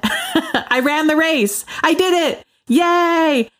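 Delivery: laughing, high excited delivery; beaming with pride; proud and excited. Real, not acted. Announces race finished.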